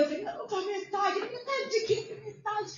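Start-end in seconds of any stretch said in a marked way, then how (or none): 0.2-1.2 high, tearful reply; crying. Real, not acted. Wife crying as she reads the exam result. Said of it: "eu comecei a chorar". 1.2-2.7 high, slow, astonished asking; surprised question. Retold or imitated. Husband asking his wife, high and astonished. Retold exchange over exam result.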